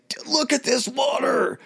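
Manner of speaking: high, excited gushing about the view. Retold or imitated. King marveling at Greek seaside, high excited gushing. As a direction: marvel at the water like a tourist. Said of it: "he's like".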